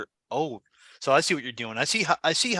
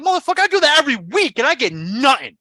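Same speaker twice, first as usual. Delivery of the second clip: mock rant; 7 semitones higher, 5 dB louder than usual. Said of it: "I'm like".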